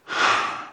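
a long, wordless sigh to God; heavy sigh. Shown as a demonstration. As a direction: sigh it out, all breath. Demonstrating the sigh of the psalm.

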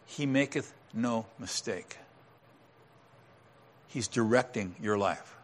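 quiet sincere promise to the believer; hushed earnest assurance. Shown as a demonstration. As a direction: say it gently so it sinks in. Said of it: "hear me".

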